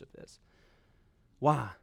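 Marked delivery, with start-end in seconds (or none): none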